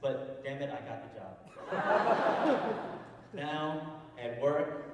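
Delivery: normal talking voice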